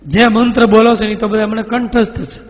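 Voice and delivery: high, loud bragging ghost voice; boastful ghost. Acted. Ghost boasting to the exorcists, loud and high. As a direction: crow it like a know-it-all spirit. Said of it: "ઓલા કે".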